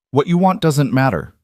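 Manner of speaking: harsh, sharp retort. Acted. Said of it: "came out harsher than she meant them".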